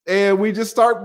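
ordinary talk

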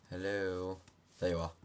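ordinary talk

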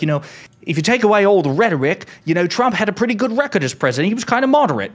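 high, loud, over-the-top Cockney-style British accent, imitating Piers Morgan; a hammed-up British accent, pitched well up. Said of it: "that was a decent British accent".

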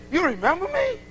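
rising, mock-astonished question; pleasantly surprised. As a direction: act out being amazed someone knows you. Acted surprise at being remembered.

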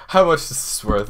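quick, eager, under the breath; fast excited mumble; rushed question. Real, not acted. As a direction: blurt it out fast and low. Asks the value in a rush.

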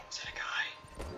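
sudden gasp, then whispered question; startled whisper. Real, not acted. Startled in game.

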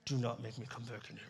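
low, quiet, menacing dad warning; a father's quiet threat through the door at bedtime; low stern warning. Retold or imitated. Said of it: "the glare".

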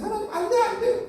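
high, soft protest; high-pitched mock refusal; reluctant Moses. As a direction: play a small, unwilling voice pleading to be let off. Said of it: "라고 얘기했어요".

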